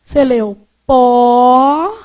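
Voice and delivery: "pó" clipped short and slow, the -len dropped; imitating a misreading. Shown as a demonstration. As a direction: mimic a student stopping at "pó". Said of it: "não tem um sonzinho de pólen". (imitando o aluno que leu só "pó")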